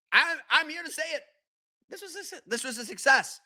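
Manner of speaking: high-pitched, halting announcement; tentative proclamation. Real, not acted. Podcaster admitting the All-Star Game worked, high-pitched and tentative.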